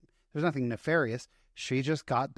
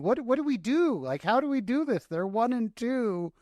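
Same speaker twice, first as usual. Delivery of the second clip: much higher, bewildered pleading questions; retold new-parent panic; 9 semitones higher than usual. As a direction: act out the overwhelmed new dad asking his wife what to do.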